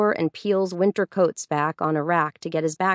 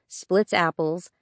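usual speaking voice

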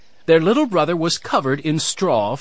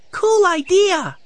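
put-on high, loud, drawn-out little-boy cheer; thrilled six-year-old boy; excited cry; 5 dB louder, slower than usual. A narrator's reading. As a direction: cry it out slowly and high with delight. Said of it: "cried Benny".

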